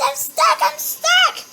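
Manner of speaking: high, loud panicked yell; panicked cry. Real, not acted. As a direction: shout it in rising panic. Trapped while chased.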